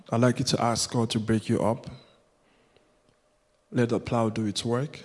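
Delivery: ordinary delivery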